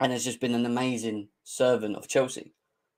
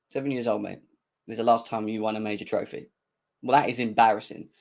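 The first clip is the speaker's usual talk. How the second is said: ordinary talk, nothing marked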